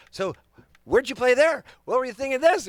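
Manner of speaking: high excited questions; excited fan. Retold or imitated. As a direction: gush like a fan.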